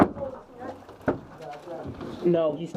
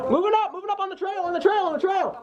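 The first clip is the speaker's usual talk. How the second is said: shouted callout; 10 semitones higher than usual. Real, not acted. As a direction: yell the advance to your team. Calling movement to team.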